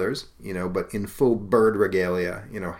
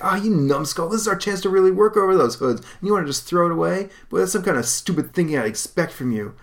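much higher, loud scornful voice; contemptuous anger; 10 semitones higher, 5 dB louder than usual. Acted. Hank berating Don for refusing, scornful anger. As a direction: chew him out.

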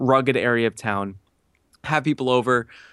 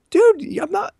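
much higher, louder pleading to the ad company; exasperated plea; 4 dB louder, slower than usual. Retold or imitated. Retelling his plea to the ad company, exasperated. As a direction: voice jumps up in disbelief.

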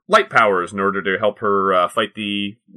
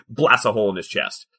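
normal talking voice

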